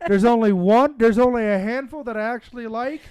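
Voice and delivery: loud, heated rant; angry rant. Real, not acted. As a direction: vent your anger loudly. Rant about direct-to-DVD movies.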